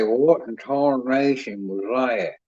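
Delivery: slow mock country drawl. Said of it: "He'd say".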